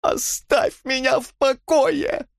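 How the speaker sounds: very high, drawn-out furious cry; sudden roar. A narrator's reading. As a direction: explode into an anguished roar. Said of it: "неожиданно взревел он". (внезапный яростный рёв)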